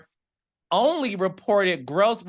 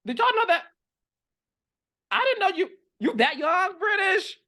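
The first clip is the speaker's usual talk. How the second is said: shocked disbelief; 12 semitones higher, faster than usual. Real, not acted. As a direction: voice jumps way up, stunned. Shocked at her age.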